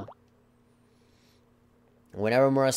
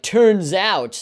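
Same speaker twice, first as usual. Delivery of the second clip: mocking quote; 9 semitones higher, 9 dB louder than usual. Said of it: "why you say".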